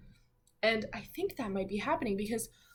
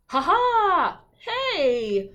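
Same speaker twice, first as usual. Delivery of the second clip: higher, louder taunting laugh; a mean kid shoving past; bully jeering; 8 semitones higher, 9 dB louder, much slower than usual. Acted. Billy the bully jeering as he shoves past, higher and louder.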